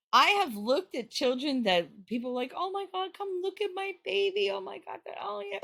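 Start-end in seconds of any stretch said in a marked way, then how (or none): 2.5-5.6 high, squealing mock delight; fake gushing parent. Parent calling friends to admire the baby, squealing high. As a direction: gush way up high like a proud parent.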